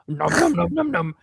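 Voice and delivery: greedy smacking while eating, high monster voice; Cookie Monster munching. Pretend. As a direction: gobble it up noisily like a puppet monster. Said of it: "Read in me voice".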